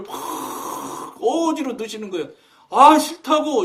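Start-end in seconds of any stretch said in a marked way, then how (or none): 2.7-3.6 protesting refusal. Retold or imitated. Refusing the envelope.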